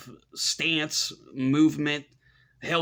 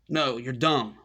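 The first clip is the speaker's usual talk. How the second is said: ordinary talk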